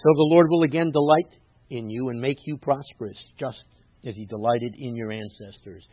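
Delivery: forceful reading of sacred text; emphatic scripture reading. Acted. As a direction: declaim the promise to the congregation. Reads scripture aloud, emphatically.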